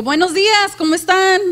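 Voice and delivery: high, loud, cheerful greeting; bright greeting. Shown as a demonstration. Preacher demonstrating tone to congregation, loud cheerful greeting. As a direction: greet the church with real enthusiasm. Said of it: "el tono de voz".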